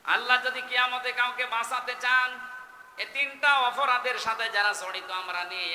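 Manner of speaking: high, loud sermon delivery; full-force preaching. Real, not acted. Preacher speaks of salvation on Judgement Day, high forceful preaching. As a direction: project it high over the crowd.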